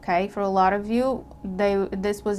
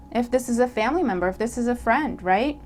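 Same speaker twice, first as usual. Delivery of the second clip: ordinary delivery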